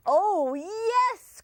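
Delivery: high, loud gleeful fox voice; gleeful cry. Acted. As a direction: crow over the catch. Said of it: "cried the fox".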